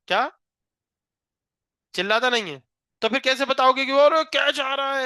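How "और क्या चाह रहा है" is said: higher, louder mock fielder's call; cricket appeal shout. Shown as a demonstration. Teacher demonstrates a fielder's catch shout to the class. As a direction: show the class how to yell for a catch. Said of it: "ऐसे चिल्लाते".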